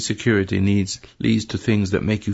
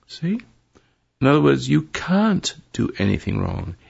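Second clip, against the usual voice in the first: ordinary talk, nothing marked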